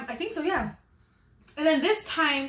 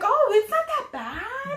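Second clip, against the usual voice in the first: high relieved; 11 semitones higher, slower than usual. Retold or imitated. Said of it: "I was like".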